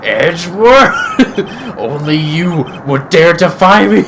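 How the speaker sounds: very loud, slow drawn-out name then laugh; villain's rage. Acted. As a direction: bellow his name in fury. Villain exposed, raging.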